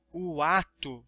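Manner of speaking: ordinary delivery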